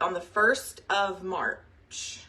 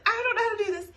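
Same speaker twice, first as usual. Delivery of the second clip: high, louder half-laughing panicked wail; mock panic; 5 dB louder, much faster than usual. New consultant when covid hit, laughing mock panic. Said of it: "I was like".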